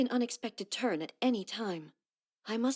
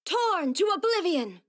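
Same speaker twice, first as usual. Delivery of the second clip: battle cry; 8 semitones higher, 5 dB louder than usual. Acted.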